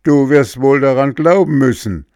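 loud, high, threatening ogre; indignant ogre. A narrator's reading. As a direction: menace the cat with it. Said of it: "rief der Oger entrüstet".

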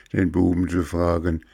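usual speaking voice

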